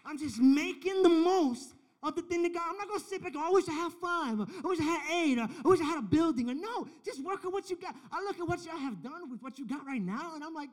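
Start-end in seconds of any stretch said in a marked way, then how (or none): 0.1-7.9 preaching at full force. Acted. Preaching climax.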